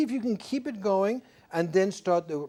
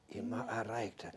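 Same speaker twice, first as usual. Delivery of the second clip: low, near-whispered German narration; hushed storytelling; 8 semitones lower, 15 dB quieter than usual. Shown as a demonstration. Coach showing the singer the storytelling line, hushed. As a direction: tell it softly, as a secret tale. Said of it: "Now it's like we're telling the story".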